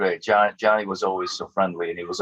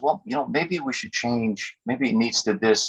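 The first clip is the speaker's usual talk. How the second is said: higher, lightly acted musing; tentative pondering; 4 semitones higher than usual. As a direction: think aloud as a doubtful producer. Retold producer pondering possible changes.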